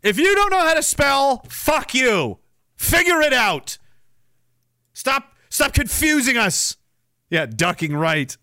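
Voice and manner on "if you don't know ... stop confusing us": higher, slowed indignant rant; hammed-up fury at autocorrect; mock angry rant. Real, not acted. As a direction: scold the bad spellers. Angry rant at bad spellers.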